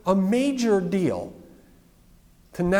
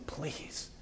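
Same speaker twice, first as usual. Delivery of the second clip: breathless whispered please; a leper begging Jesus in a whisper; 15 dB quieter than usual. Retold or imitated. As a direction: barely voice it, begging.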